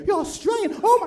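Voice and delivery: put-on high-pitched fan melting at the accent; swooning American admirer. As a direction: gush in a high, smitten squeal. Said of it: "Americans love the Australian accent".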